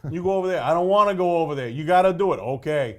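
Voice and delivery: loud, clipped back-and-forth of two gruff characters; terse movie dialogue. Retold or imitated. Mocking the film's short dialogue, gruff loud exchange. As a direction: bark short lines like a laconic New Englander. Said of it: "that's, like, half the script".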